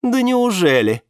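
high, loud mocking disbelief. A narrator's reading. Woman scoffing at her friends' faith in Alex, mockingly. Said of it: "насмешливо отозвалась Валериана".

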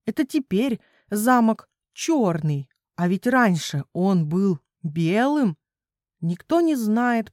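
usual speaking voice

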